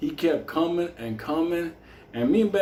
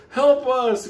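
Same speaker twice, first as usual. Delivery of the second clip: mock cop plea; 8 semitones higher, 4 dB louder than usual. Cop asks for help.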